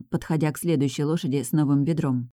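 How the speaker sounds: ordinary delivery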